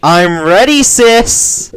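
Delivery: high, loud, eager; excited puppy kid voice. Acted. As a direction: shout back happily.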